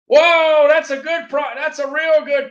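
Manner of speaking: a loud, high burst of amazement at a price; real surprise. Real, not acted. Spotting a bargain on screen, a loud surprised whoa. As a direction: gasp out a genuine whoa.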